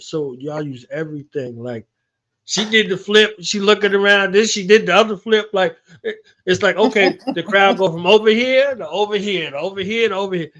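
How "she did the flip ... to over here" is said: excited retelling. Real, not acted. Excitedly describing the scene.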